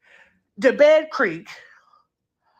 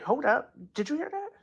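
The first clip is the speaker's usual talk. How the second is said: hushed alarm; 2 semitones higher, 7 dB quieter, faster than usual. Retold or imitated. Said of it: "Nobody yells that".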